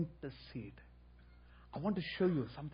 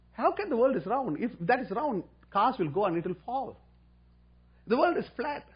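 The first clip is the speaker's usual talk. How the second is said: a lightly voiced, high, loud indignant father; mock illiterate father; 7 semitones higher, 4 dB louder than usual. Stubborn father arguing with his son, mock indignant. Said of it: "He listened and said".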